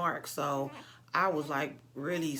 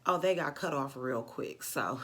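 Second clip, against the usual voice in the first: normal talking voice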